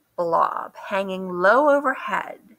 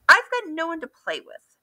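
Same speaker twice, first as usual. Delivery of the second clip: lonely Duck; 9 semitones higher than usual. A narrator's reading.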